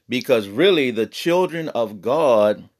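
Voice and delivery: ordinary delivery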